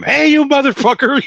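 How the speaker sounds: high-pitched retold yell; mock yelling. Driver seeming to yell at the drive-thru guy, high mock yelling. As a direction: holler it high, as if at someone. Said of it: "like I'm yelling at him".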